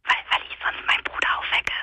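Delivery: hushed whisper; whispering so nobody wakes; whispering on the phone. Real, not acted.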